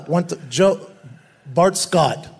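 usual speaking voice

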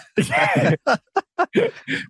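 high burst of laughter. Real, not acted. Host reacting to the short interview, big laugh.

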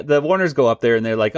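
ordinary talk

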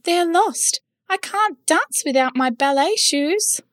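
high, distressed ballerina voice; crying ballerina. A narrator's reading. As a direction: wail it like a little dancer who lost her shoes. Ballerina voice continues.

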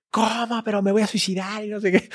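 high, softened whiny mock sob; mock crying. Patient protesting to tough therapist, imitated tearful whine. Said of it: "Y yo llorando así de".

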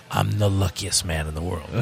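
low put-on character voice; father's commercial character. Host voices father's line from their commercial, low character impression. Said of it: "And our father played".

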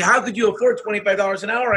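high-pitched mock disbelief; incredulous question. Other employers questioning his pay rate, incredulous. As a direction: imitate a baffled employer, pitched well up.

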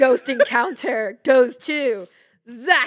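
slow, loud awards presenter; mock award announcer. Listener jokingly presenting an Emmy, mock announcer. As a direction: draw out the winner's name loudly.

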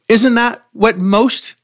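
ordinary talk

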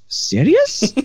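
put-on high Egyptian-accented "Serious?" falling at the end; the boss doubting the order count, Egyptian accent. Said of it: "Pretty good Egyptian accent".

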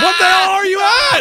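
high, loud exasperated shout; retold angry yell. Son yells at his dad on the phone, high and loud. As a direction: yell down the phone at him in disbelief.

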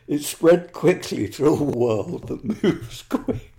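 slow, breaking into laughter mid-line; reading while fighting a laugh; stifled giggles. Real, not acted. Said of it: "I'm finding it difficult to keep a straight face".

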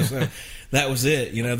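ordinary talk

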